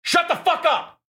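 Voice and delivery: angry shout. Acted. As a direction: scream him down. Furious boss bursts in.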